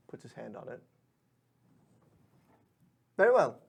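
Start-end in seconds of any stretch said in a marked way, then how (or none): 3.2-3.6 higher, louder and formal; obliging pixie. Acted. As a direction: offer service politely. Pixie yields to warden badge.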